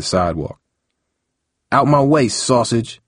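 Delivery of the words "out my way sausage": rough growl, raised and higher. Acted. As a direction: snarl the insult as you shove past. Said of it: "growled Jupiter".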